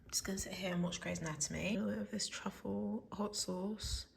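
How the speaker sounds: soft, hushed unvoiced whisper. Real, not acted. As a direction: whisper so nobody wakes. Quiet evening at home.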